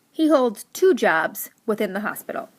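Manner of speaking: normal talking voice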